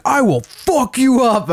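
high, drawn-out imitation of a threat; mock his big empty threat, slowly; mock chirping threat. Imitating the stranger threatening him, high mock menace. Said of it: "He just starts chirping me".

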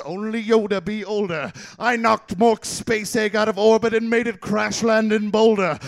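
ordinary delivery

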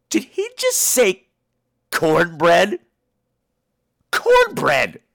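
slow, puzzled retold disbelief; acted bewilderment; baffled disbelief. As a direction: work it over, bewildered, with long pauses. Baffled reaction in retold scene.

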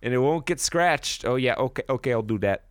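put-on high chirpy Midwest accent; Midwest lady voice. Barb and Star movie voice.

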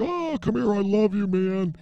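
high, far-off gushing voice; mock bear hug. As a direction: gush like you're hugging her tight. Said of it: "I'd be like".

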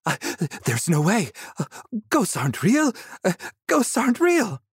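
high, gasped disbelief; panicked gasping. Acted. Young man fleeing a ghost, panicked gasping. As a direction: gasp it out mid-flight in panic. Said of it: "he gasped as he stumbled".

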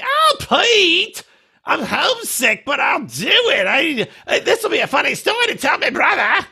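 very high, exaggerated attempt at a New Zealand accent; hammed-up shrieky bad New Zealand accent; Aisha. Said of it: "she's like".

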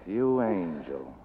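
slow, soft, sarcastic endearment; bitter sarcasm. Acted. Detective mocking the killer's love confession, slow and soft. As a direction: drawl the endearment with contempt.

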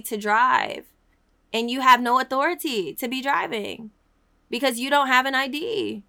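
preaching at full force. Acted. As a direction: preach it hard to the congregation.

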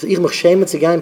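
ordinary delivery, nothing marked

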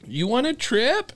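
high, slow teasing question; high drawn-out mocking question; mock incredulous. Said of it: "Muck's like".

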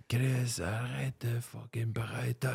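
reproche chuchoté d'un auditeur; auditeurs agacés en chuchotant. Retold or imitated. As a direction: chuchote comme un auditeur excédé. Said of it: "Mais ils sont probablement comme". (auditeur impatient qui chuchote)

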